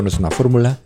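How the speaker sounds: ordinary delivery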